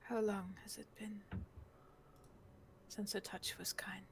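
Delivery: hushed, gentle question with pauses; tender near-whisper. Acted. Moira looks over the caged girl, tender hushed asking. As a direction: ask it softly, almost whispered.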